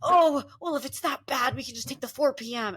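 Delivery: mock a boomer's easy fix; imitating a traveller; fussy boomer. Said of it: "And they go".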